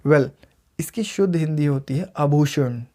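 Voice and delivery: normal talking voice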